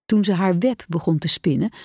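usual speaking voice

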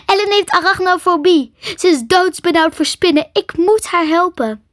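high, loud frantic protest; shouting. A narrator's reading. Crystal to friends holding her back from Ellen, shouting. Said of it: "schreeuwde Crystal en steigerde".

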